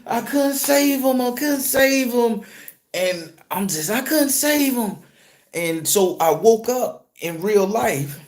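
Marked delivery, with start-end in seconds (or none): 0.1-2.4 slow, high-pitched wail; acted bawling. Driver on the ground after the explosion, acted bawling. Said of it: "I'm bawling, crying". 3.9-5.0 high-pitched grieving wail, retold; acted bawling. Driver rolling on the ground, acted bawling. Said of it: "I'm bawling, crying".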